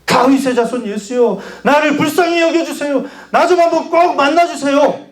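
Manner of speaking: high, urgent pleading call; insistent crying out. Acted. Blind man calls over the crowd to Jesus, insistent high cry. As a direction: keep calling, pitched high, begging to be met. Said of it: "더 큰 목소리로".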